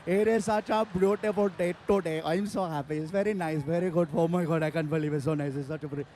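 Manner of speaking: put-on a mild cheerful Indian accent bobbing along; comic describing head wobble, soft Indian accent. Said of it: "With Indians, it's all the time".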